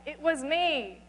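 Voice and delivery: shouting. Acted. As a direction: cry out the confession at his feet. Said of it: "I shouted out".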